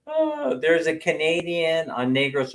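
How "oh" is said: ordinary talk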